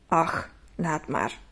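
slow, soft mumble giving up; muttering as he leaves the shop; mumbled. Acted. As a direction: let it drop, barely audible. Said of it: "mompelde hij nog".

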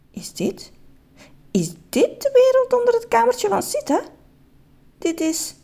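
hushed false start, then high wonder; stammered amazement. Acted. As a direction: falter in high-pitched amazement. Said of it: "Meneer Just stamelde verder".

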